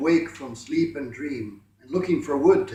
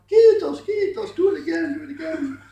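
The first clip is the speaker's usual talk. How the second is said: very high, loud delighted cry; joyful yell; 12 semitones higher, 8 dB louder than usual. A narrator's reading. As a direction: beg for more with glee. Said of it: "the Finn yelled".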